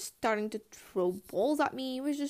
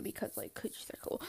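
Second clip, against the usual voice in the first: whisper; 10 dB quieter than usual. Real, not acted. Secret aside.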